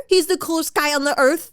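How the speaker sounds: exasperated, scornful repeat; mocking echo. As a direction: throw the gushing words back mockingly. Sarcastic echo of the gushing quote.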